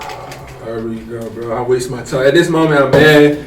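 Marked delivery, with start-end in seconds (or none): 0.0-2.3 low, hushed weariness; resigned. Retold or imitated. Man told his stuff will be packed, quiet resignation. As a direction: act out a deflated mutter to yourself. Retells resigned reaction to being kicked out.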